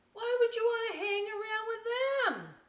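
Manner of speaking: high, loud sing-song call; offstage voice. Acted. Nerdy bird calling from offstage to the vulture, high sing-song. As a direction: croon it from the wings. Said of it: "says another voice from off the stage".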